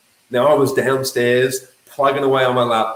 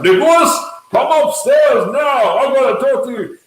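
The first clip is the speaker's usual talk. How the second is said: dad yelling; 10 semitones higher, 5 dB louder than usual. Retold or imitated. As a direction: demand he come up now. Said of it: "he yelled out".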